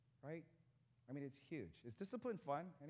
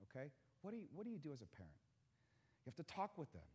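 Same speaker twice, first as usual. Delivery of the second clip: normal talking voice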